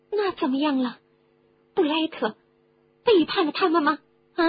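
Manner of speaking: high-pitched, prying questioner; inquisitive listener. Acted. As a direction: press for the answer in a high character voice. Voices a questioning listener in the story. (高声追问的角色)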